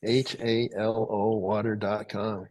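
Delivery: web address read out very slowly; slow, measured announcing of a web address; slow dictation. Real, not acted. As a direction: spell it out slowly for people writing it down. Asked to give website to listeners.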